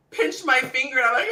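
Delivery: ordinary delivery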